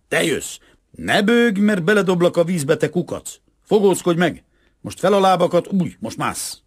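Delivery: high, loud, scolding commands; yelled bullying orders. A narrator's reading. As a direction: boss the frightened child along loudly. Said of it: "ordította Lubovszki".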